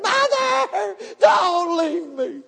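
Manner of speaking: high, drawn-out wailing cry; acted terrified daughter's wail; screamed desperate plea. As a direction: scream for your mother as she leaves, stretching each word. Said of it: "let out this bud-, blood-curdling".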